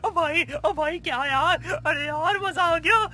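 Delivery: can't contain himself; giddy excitement. Acted. Overjoyed suitor can't control his excitement, giddy. Euphoric over proposal.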